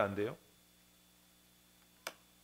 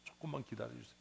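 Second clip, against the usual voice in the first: softer, humble begging; 10 dB quieter than usual. Acted. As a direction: plead quietly with the king. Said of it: "신하가 빌지요". (낮게 애원하듯)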